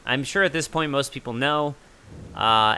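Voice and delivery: usual speaking voice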